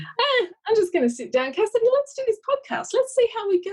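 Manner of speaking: high-pitched, breezy quote of someone winging it; mock-casual, sing-it-out offhand voice; imitated casual. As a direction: toss it off lightly, pitched well up.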